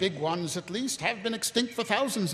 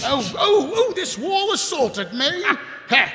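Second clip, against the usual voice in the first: put-on giggly, higher, louder drunk babble; drunken laugh; 6 semitones higher, 5 dB louder than usual. Drunk captain giggling as he bumps into the wall. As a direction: laugh it off like a tipsy fool.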